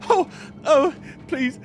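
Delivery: high, fearful pleading; cowering goblin begging the giant not to kill him; frightened begging. Acted. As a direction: grovel and beg for mercy. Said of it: "on the ground in front of him and goes".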